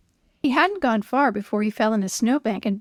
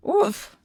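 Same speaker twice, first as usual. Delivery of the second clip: oof grunt; 9 semitones higher than usual. A narrator's reading. Sled hits bump.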